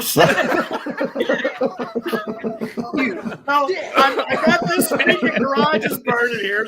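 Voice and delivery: high, laughing call for help; can't keep a straight face. Real, not acted. Firefighter retelling the chaos, laughing through it.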